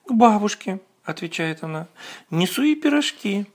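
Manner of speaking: put-on little girl voice. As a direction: play a small innocent girl. Girl answers the wolf.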